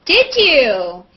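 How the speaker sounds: ordinary talk, nothing marked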